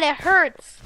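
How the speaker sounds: usual speaking voice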